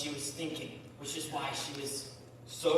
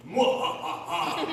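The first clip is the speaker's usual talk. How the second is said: villain dragon laugh; 9 semitones higher, 5 dB louder, slower than usual. Acted. Dragon threatens hero in story.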